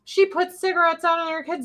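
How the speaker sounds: high mock gossipy voice; mock gossip. Mocking one side's rumor, high gossipy mock voice. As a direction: sneer it like a rumor-monger. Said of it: "one side like".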